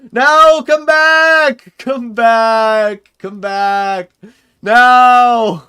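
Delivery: high, loud pleading yells; desperate shouting. Real, not acted. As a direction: shout after it to come back. Target slipping away in game.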